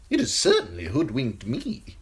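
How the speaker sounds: high, sly eunuch voice, unhurried; high giggly spymaster relishing the gruesome news; Varys the eunuch. Acted. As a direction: purr it high and insinuating, a courtier savouring gossip. Varys voice held.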